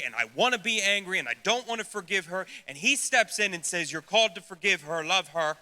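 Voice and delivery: loud, driving sermon voice; impassioned preaching. Acted. Preacher to congregation on anger at God, loud impassioned preaching. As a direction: preach it with fire and volume.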